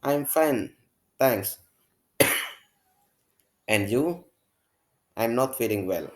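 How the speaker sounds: bright, performed classroom dialogue; acted dialogue reading. Teacher reads the Wisnu and Kinar dialogue to the class, acted out. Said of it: "saya ulangi sekali lagi".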